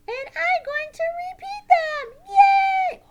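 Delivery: very high, loud puppet squeal; squeaky, overjoyed puppet cheer; excited puppet Sally. Pretend. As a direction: shout it as a hyper puppet.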